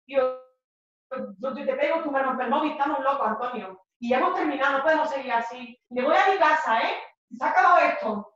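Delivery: worked-up voice, ending loud and high; agitated break-up outburst. Acted.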